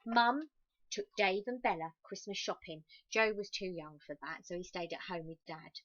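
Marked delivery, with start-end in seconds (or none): none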